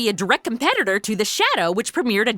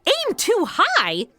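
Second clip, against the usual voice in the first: incredulous; 8 semitones higher, much slower than usual. Acted. Incredulous echo of the explanation.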